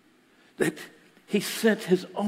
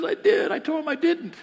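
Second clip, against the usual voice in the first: retold tearful protest; 11 semitones higher, 4 dB louder than usual. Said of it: "She's in tears".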